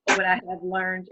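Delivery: ordinary talk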